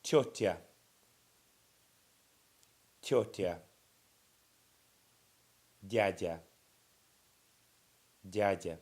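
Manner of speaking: тётя and дядя said loud and clearly, each twice; teacher models тётя and дядя for repeating; model pronunciation. Shown as a demonstration. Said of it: "next line down".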